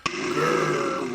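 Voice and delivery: loud, rumbling growl; long growled animal noise; bear roar. A narrator's reading. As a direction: roar like a big brown bear. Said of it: "roared the big brown bear".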